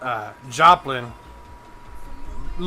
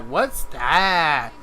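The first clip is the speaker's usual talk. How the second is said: mocking Northeasterner; slower than usual. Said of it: "they're like".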